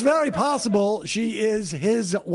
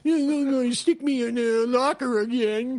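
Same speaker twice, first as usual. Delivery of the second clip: whiny nerd voice; 3 semitones higher than usual. Pretend. Mocking a bullied nerd.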